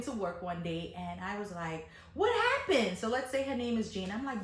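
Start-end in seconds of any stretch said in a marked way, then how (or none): 2.1-3.0 high, startled what happened; asking in disbelief; surprised question. Retold or imitated. Narrator asks Jane, startled and high.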